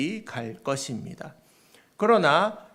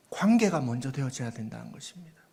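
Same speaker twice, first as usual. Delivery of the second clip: ordinary delivery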